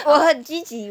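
says it while laughing loudly. Real, not acted. Co-host joking she's so positive, laughing hard. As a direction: laugh through the claim.

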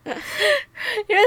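high laughter breaking in; laughing. Real, not acted. Laughing to friend while recalling the club guy. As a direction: laugh high, almost crying.

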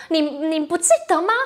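ordinary talk

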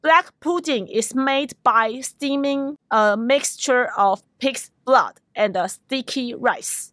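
slow, careful teaching read; slow model sentence. Shown as a demonstration. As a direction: read it slowly for learners. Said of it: "你可以说".

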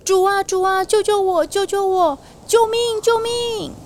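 loud cry for help. Acted. Peter sinking in the sea shouts to his Lord, desperate. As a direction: yell for rescue like you're sinking. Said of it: "很大声的叫说".

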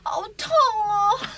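high, loud, drawn-out wail; milk the pain theatrically; mock whining over a fall; exaggerated pain moaning. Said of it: "这种呻吟声".

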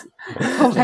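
a sudden high burst of laughter; cracking up at a friend's joke. Real, not acted. Guest jokes it was static, teller bursts out laughing.

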